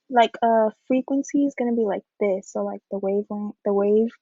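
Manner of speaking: ordinary talk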